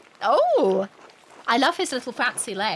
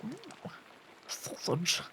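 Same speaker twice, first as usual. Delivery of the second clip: hushed sausage; 12 dB quieter, much slower than usual. Pretend. Hushed food joke.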